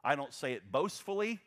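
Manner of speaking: normal talking voice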